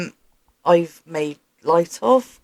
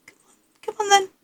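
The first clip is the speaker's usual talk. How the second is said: high coaxing dog voice. Real, not acted.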